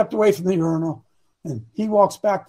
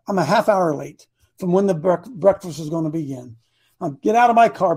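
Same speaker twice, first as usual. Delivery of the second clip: ordinary talk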